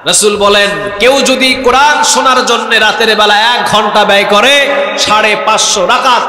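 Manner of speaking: fervent sermon delivery; preaching. Acted. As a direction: proclaim the hadith to the gathering. Preaching a hadith loudly.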